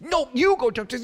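fast, high, loud retort imitating wife; mock heated bickering. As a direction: fire it straight back in hammed-up squabbling. Acted escalating marital argument.

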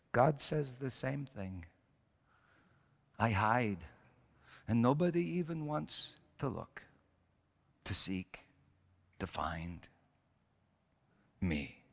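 slow, hushed, with long pauses; sorrowful lament. Retold or imitated. Old rabbi weeping as he voices God's lament, sorrowful. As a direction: let it sink to a near whisper.

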